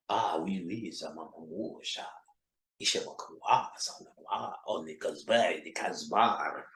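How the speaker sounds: hushed, breathy fake French accent, seductive; fake French seduction. Seducing a woman with fake French accent whispers. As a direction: purr fake French words like a lover. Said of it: "I talk that fly Frenchish shit".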